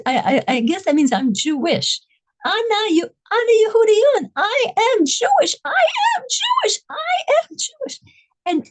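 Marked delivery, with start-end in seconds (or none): 2.5-8.0 screaming. Retold or imitated. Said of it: "she starts screaming from somewhere in her body".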